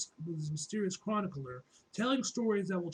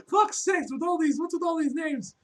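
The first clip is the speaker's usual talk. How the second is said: loud high frustrated outburst; exasperated yell; 10 semitones higher, 8 dB louder than usual. Real, not acted. Stumbling over agency acronyms, exasperated loud yell. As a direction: throw up your hands and yell.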